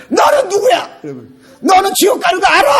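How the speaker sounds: high, loud, hammed-up challenge; confrontational retort. Shown as a demonstration. Preacher acting a comeback to anti-Christians, confrontational shout. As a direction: confront the critic head-on.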